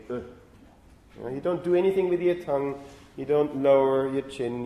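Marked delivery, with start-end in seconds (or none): 0.1-0.2 "Uh" as a short, slack schwa; lazy schwa. Shown as a demonstration. Teacher demonstrating the laziest vowel, "Uh" as a relaxed schwa. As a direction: say "Uh" with the mouth barely open. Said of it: "barely open your mouth and say".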